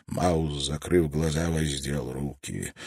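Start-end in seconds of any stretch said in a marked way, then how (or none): none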